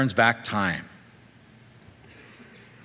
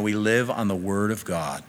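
usual speaking voice

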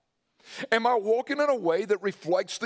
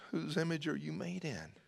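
hushed question; 11 dB quieter than usual. Real, not acted. Preacher pressing the congregation, softer and searching. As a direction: drop to a near-hush, softer, searching.

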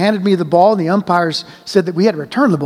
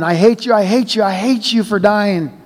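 kept yelling; 3 semitones higher than usual. Acted. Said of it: "I kept yelling".

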